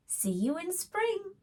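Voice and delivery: high, bright-hearted goodbye; cheerful hedgehog. Acted. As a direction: wave off friends, voice lifted high. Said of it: "Hedgehog called".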